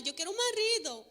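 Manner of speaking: high, loud imitated child's wail; hammed-up tearful little-girl whine; mock crying girl. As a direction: imitate a toddler crying for what she wants. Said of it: "muy llorando, llorando con lágrimas".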